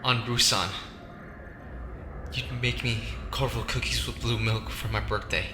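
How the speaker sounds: ordinary delivery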